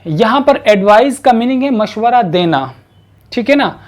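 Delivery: usual speaking voice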